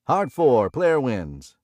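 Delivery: loud, high stickman call; casino caller. A narrator's reading. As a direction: sing out the result to the table. Stickman calling the roll.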